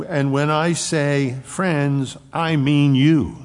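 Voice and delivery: slow, with I mean you punched high and loud; stress on you. Shown as a demonstration. Preacher pressing the disciples, I mean you. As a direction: land hard on the final you. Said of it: "There's an emphasis".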